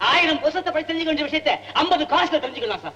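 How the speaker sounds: high-pitched guide's patter; high, insistent hawker's spiel; tout's sales pitch. Acted. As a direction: pitch the tour eagerly to a tourist. Guide touting for a customer.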